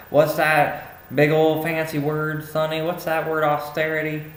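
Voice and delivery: hammed-up puzzled grandma quizzing Sonny; bad grandma accent. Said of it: "that was my really bad accent".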